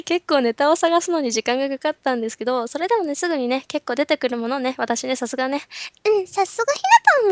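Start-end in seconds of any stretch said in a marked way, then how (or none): 6.1-7.3 much higher, pleased self-praising voice; smug self-praise. Retold or imitated. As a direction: congratulate yourself smugly. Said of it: "みたいな感じで".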